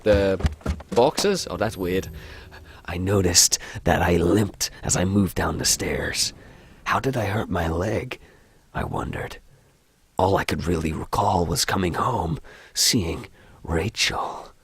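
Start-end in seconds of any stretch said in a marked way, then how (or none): 2.9-14.5 low-key American horror narrator; American accent. Acted. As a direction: read the story in an American accent. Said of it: "I don't like the American accent".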